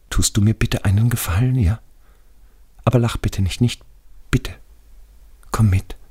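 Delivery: hohe, bittende Stimme eines Gefallenen; ängstlicher toter Schulfreund. Acted. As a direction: als toter Freund scheu um Verständnis bitten. Radi begs a favour. (hoch, unsicher und eindringlich)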